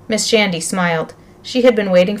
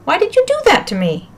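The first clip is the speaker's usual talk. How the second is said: crying out; 11 semitones higher than usual. Acted. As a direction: wail it like a wronged victim. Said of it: "Miles cried out".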